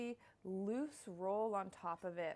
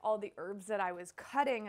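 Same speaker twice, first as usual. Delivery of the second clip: usual speaking voice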